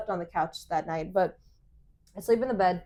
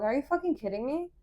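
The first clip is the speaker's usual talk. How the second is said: incredulous; 5 semitones higher, 3 dB quieter than usual. Retold or imitated. Retold incredulous comeback to boyfriend.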